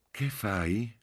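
high, tenderly inflected question; yearning tenderness. A narrator's reading. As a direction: ask with melting tenderness. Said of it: "con un'inflessione di tenerezza struggente". (con tenerezza struggente, in tono alto)

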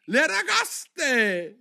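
high, loud, drawn-out shriek; shouted cry. A narrator's reading. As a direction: yell it out with a great leap of the voice. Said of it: "gridò il grifone". (grido acuto e lungo)

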